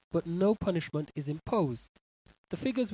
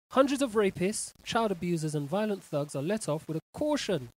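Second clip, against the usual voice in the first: ordinary delivery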